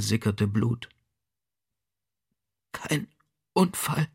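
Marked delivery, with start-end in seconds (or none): 2.7-4.1 faint, much softer whisper; dying woman breathes it out. A narrator's reading. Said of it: "wisperte sie".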